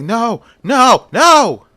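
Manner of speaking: high, loud cries of panic; acted terrified scream. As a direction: scream it out, trapped and desperate, each cry climbing. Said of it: "He starts screaming".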